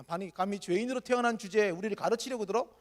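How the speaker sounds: usual speaking voice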